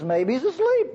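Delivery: mock-helpful taunt, pitched high. Preacher retells Elijah taunting the prophets of Baal, mocking. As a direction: needle them with a lifted, teasing suggestion. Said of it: "Elijah was mocking them".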